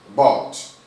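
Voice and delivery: bought said loud and clear as one syllable, bawt; the teacher models bought for students to copy; pronunciation demo. Shown as a demonstration. Teacher to students, pronouncing bought loudly for them. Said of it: "E o passado".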